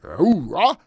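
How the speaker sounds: Marine bark. Retold or imitated. Stock host hams up a Marine's cry for chat, mock shout. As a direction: bark it like a drill-field Marine, hammed up. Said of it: "I can sound like a Marine and go".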